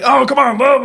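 high-pitched needling, acted out; playful goading. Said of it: "poking her".

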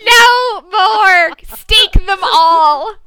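high, loud anguished scream; mock screaming. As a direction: shriek it in mock despair. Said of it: "[name] screaming".

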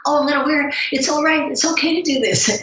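a high little voice telling herself it's fine; self-justifying inner voice. Retold or imitated. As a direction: coax yourself into buying it. Talking herself into a purchase.

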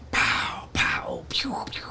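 soft unvoiced pow pow pew pew; whispered gunshots. Narrator imitating distant New Year's gunshots, whispered pows. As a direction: mimic far-off shots under your breath.

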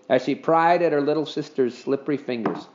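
ordinary delivery, nothing marked

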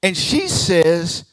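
usual speaking voice

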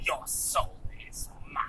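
1 mock whisper. Mock creepy line from the girl.

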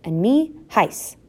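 slow, mildly wounded reminder; rather hurt. A narrator's reading. Husband forgotten at roll call, mildly hurt. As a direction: sound a little left out, slowly. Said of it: "said a rather hurt voice".

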